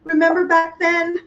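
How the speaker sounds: high, knowing mom voice, lightly voiced; teasing mom. Mom teasing her watermelon-loving kid, mock knowing voice. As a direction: tease like a mom reminding you. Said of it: "she probably say".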